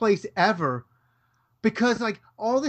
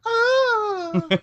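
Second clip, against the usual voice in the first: excited cry. Retold or imitated. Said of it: "I was like".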